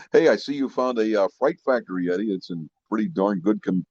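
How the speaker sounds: normal talking voice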